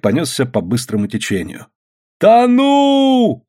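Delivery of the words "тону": high, long, loud cry for help; drowning yell. A narrator's reading. Policeman swept off by the current, high yell. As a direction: yell it high and hold the vowel. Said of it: "орал он".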